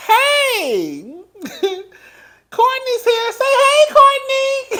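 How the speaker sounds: high, loud mock-cheery caller; gleeful phone greeting. Imitating Drew calling Candy with Courtney there, gleeful high holler. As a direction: holler a hello, tickled and stirring trouble.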